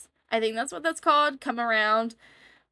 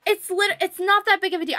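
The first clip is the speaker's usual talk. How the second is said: higher and louder, defensive; indignant; 9 semitones higher, 5 dB louder than usual. Real, not acted. Vlogger answering angry commenters, indignant and raised. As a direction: push back hard at the critics.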